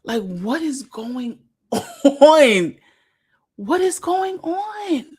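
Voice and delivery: incredulous, laughing. Real, not acted. Disbelief at the clip.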